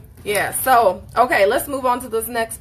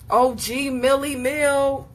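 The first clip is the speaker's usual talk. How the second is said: slow, drawn-out tough-guy name call; lightly playing the OG, a bit slower; OG impression; 4 semitones higher, slower than usual. Teasing the group by name-dropping in an OG voice. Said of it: "Was that O G-ish enough?".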